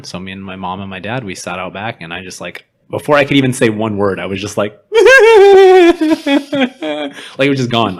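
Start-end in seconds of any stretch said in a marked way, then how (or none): 4.6-7.5 loud, very high mock sob burst; imitated blubbering. Showing friends how she broke down before parents, loud mock wail. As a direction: wail it out like a kid breaking down. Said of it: "I was blubbering like a little kid".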